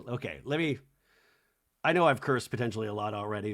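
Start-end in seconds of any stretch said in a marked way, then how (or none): none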